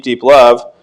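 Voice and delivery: ordinary talk, nothing marked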